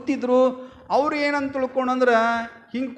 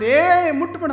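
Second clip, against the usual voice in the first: higher, louder warning cry; alarmed scolding; 7 semitones higher, 6 dB louder, slower than usual. Acted. Miser husband stops wife touching the gold, alarmed scolding. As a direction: cut her off with a sharp, raised "don't".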